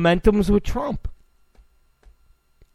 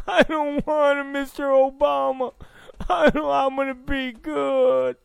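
pleading student; 8 semitones higher than usual. Retold or imitated.

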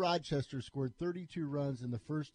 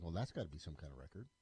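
quiet aside; 7 semitones lower, 10 dB quieter, much faster than usual. Retold or imitated. Imagined listener thought, aside.

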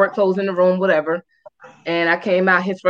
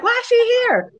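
mock indignant girlfriend. Other woman confronting her.

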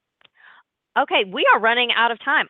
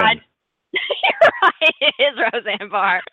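laughing; 4 dB louder than usual. Real, not acted. Host laughs at correct answer.

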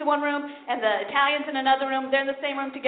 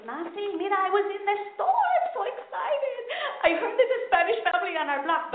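excited mom; 6 semitones higher than usual. Retold or imitated. Mother excitedly telling daughter news, high pitched. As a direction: gush like a thrilled mom.

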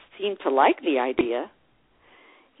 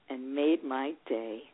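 usual speaking voice

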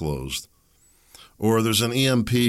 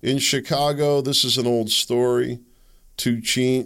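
ordinary talk

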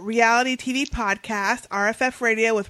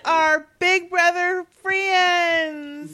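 drawn-out fondness; 6 semitones higher, much slower than usual. Real, not acted. Fond mention of friends.

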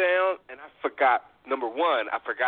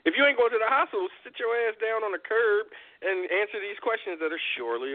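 mock scolding; 7 semitones higher than usual. Continues imagined scolding.

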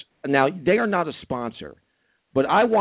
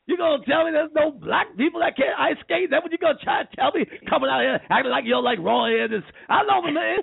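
ranting preacher voice; 11 semitones higher than usual. Acted. Ranting Reverend Al impression.

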